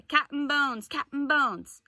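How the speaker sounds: loud pirate chant of the name; pirates' cry. A narrator's reading. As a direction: holler it like a crew taking up the call. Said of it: "took up the cry".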